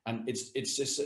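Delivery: ordinary talk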